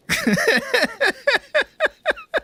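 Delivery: long, high cackling laugh; amused laugh. Real, not acted. Viewer after a relatable sitcom scene, amused laugh. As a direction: burst out and keep laughing.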